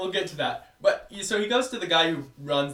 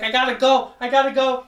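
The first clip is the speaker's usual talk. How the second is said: mock Boston accent; 10 semitones higher, 10 dB louder than usual. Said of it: "doing the worst Boston accent".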